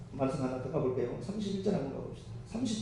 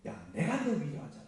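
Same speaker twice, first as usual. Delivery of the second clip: emphatic reassurance, building higher and louder; God; 5 semitones higher, 6 dB louder than usual. Retold or imitated. Preacher voicing God comforting the believer, emphatic and insistent. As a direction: insist with rising force, stretching key words. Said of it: "그 얘기하잖아요".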